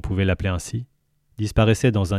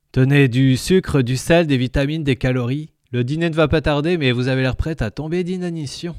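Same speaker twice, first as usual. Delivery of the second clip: gurgling tentacle alien; 6 semitones higher than usual. Acted. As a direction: speak as the jovial alien. Lintel voice.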